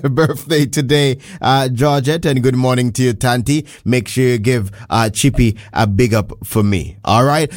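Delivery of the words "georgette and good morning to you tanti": normal talking voice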